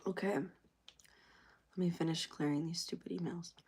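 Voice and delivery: soft, slow mutter; muttered aside. Real, not acted. Getting back to deleting emails, soft slow mutter. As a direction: mutter it slowly, back at work.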